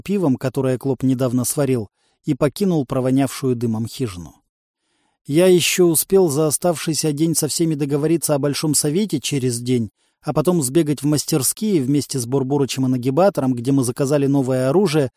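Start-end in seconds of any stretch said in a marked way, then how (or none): none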